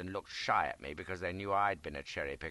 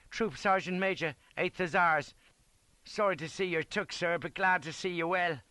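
higher, louder soldier's voice; reporting sergeant; 8 semitones higher, 4 dB louder than usual. A narrator's reading. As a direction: greet a superior officer as a ranker. Sergeant greets his officer.

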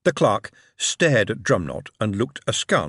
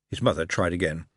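ordinary delivery, nothing marked

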